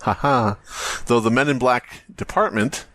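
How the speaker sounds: ordinary delivery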